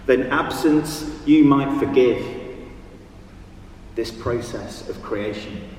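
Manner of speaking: measured poem recital with long pauses; poetry reading. Acted. Reads own poem aloud.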